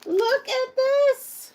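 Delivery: real excited squeal; thrilled squeal. Real, not acted. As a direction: squeal with delight over the present. Thrilled at pink earmuffs gift.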